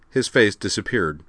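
usual speaking voice

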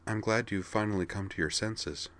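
softly. Acted. Vigdis, relieved, speaking softly to Corwin. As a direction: let it settle quietly. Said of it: "replied Vigdis softly".

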